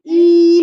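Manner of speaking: "ई" held long and steady; long drawn ee. Shown as a demonstration. Mother explains the double e, long held vowel. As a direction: stretch out "ई" to show the double letter. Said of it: "लंबा खींचे इसलिए ई".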